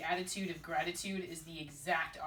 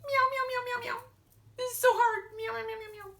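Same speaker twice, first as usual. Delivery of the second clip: pitiful meowing; 5 dB louder than usual. Acted. Mewing for sympathy.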